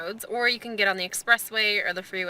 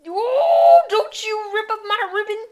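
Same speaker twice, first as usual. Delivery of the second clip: high playful pet scolding; talking to a dog; 10 semitones higher, 5 dB louder than usual. Acted. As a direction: scold the puppy sweetly.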